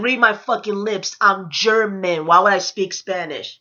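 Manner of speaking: slow, spaced-out insistence; exasperated, drawn-out comeback; emphatic retort. Retold or imitated. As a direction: spell it out slowly, word by word. Insisting to a persistent stranger.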